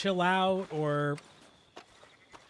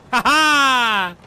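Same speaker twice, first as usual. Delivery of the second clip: triumphant laugh; 6 semitones higher, 8 dB louder than usual. Real, not acted. Finally finds the reverse button.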